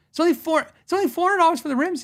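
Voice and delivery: high, loud, fast disbelief; astonished. Retold or imitated. Customer amazed the rims are cheap, high and loud. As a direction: squeak it out in happy shock.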